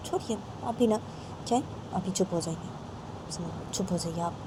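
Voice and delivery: soft, subdued shushing; acted hushing. Raima's ammi calming the quarrel, soft acted hushing. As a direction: quietly ask them to drop it.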